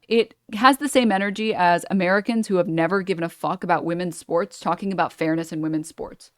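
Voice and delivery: ordinary delivery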